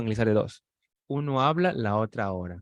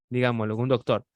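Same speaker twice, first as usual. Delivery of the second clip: ordinary talk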